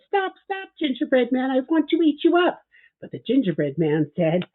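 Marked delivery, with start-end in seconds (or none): 0.1-2.6 much higher, piping pig voice calling stop; hungry pig. Acted. Pig calling after the gingerbread man, high piping voice. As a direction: squeal at the runaway cookie, hungry.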